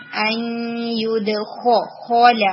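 teacher isolates the خ of یدخل; heavy letter demo. Shown as a demonstration. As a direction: give the خ in خول its weight. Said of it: "خو کو".

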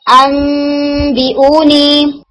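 अनबिऊनी recited slowly with nasal ghunna on the मीम; Quranic recitation. Shown as a demonstration. Teacher recites example word अनबिऊनी for students, in slow recitation. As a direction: chant अनबिऊनी, holding the नून turned मीम. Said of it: "example है".

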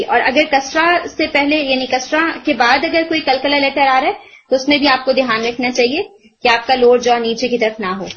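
ordinary talk

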